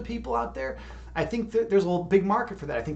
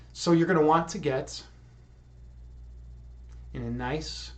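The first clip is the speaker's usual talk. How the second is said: guided meditation; 4 semitones lower, 11 dB quieter than usual. Acted. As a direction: ease the listeners into calm, unhurried and soft. Leads a meditation.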